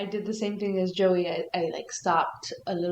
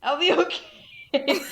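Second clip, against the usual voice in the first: breaking into a laugh on the last words, slower; laughing through it; 6 semitones higher, 3 dB louder, slower than usual. Retold or imitated. Telling a friend about putting a scary book down, laughing. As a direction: giggle the words out as you reassure yourself. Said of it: "so I'm like".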